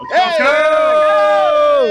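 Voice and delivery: loud excited shout. Real, not acted. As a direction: cheer the team on at full voice. Crowd cheering as team comes out.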